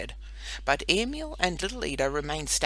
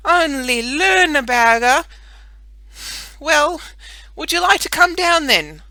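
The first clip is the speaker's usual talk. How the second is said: calling up; 10 semitones higher, 14 dB louder than usual. Acted. Boy shouting up the flagstaff to his sister, much louder. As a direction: call up the pole to her.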